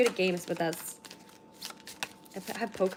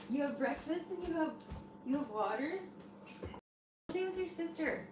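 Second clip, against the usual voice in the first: pet talk; 7 semitones higher, 10 dB quieter than usual. Real, not acted. Talking to cat.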